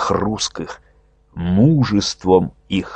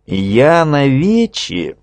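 sly schemer; 6 semitones higher, slower than usual. A narrator's reading. Nezda announcing.

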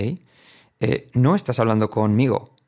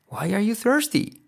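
high, slow question; 11 semitones higher than usual. Shown as a demonstration. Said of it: "por qué tienes sed".

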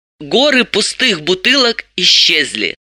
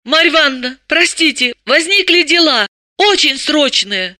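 high, apologetic woman's voice; sheepish, pleading excuse; guilty apology; 9 semitones higher than usual. A narrator's reading. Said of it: "Далила виновато уставилась".

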